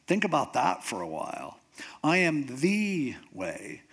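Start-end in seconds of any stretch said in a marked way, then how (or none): 2.0-3.8 "the" drawn out as a long "thee"; stress on THE. Shown as a demonstration. Preacher ruling out every other way, stress on THE. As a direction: slowly, stretch "the" into an emphatic "thee". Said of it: "That means everything else is not the way".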